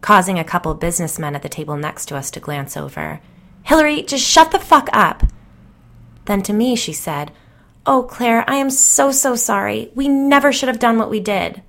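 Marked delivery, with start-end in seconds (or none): 3.6-5.2 high, loud angry shout; angry yell. Acted. Jill yelling at Hillary to shut up, loud and high. 7.9-11.6 high, pleading sorrow; tearful apology. Acted. Jill begging dead Claire's forgiveness, high and tearful.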